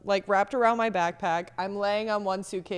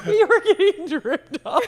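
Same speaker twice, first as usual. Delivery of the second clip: high, loud, slow laughing; 10 semitones higher, 7 dB louder than usual. Real, not acted. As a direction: giggle it out in disbelief. Laughing about dripping water.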